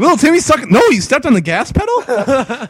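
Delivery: high, loud mock astonishment; dog owner. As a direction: repeat the dog's news back in hammed-up disbelief. Acts out owner decoding dog's message.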